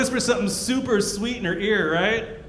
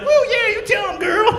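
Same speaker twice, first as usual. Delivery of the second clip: high, whooping woman in the crowd; cheering lady; 12 semitones higher than usual. Retold or imitated. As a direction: cheer like a girl hyping her friend. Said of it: "the ladies always like that".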